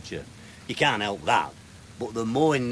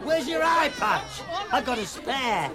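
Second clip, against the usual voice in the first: high, hammed-up pirate growl. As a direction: ham it up like a party pirate. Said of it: "Why are you talking like a pirate?".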